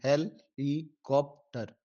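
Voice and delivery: slow, syllable by syllable; split into syllables. Shown as a demonstration. Teacher showing students the syllable split, slowly. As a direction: break the word helicopter into slow separate syllables, he-li-kop-tar. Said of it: "तो इसके चार लफ्ज़ हैं".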